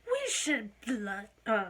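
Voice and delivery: laughing through a bad British accent while rallying the class; horrible British accent. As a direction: ham up a British rebel, cracking up. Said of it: "In that horrible accent".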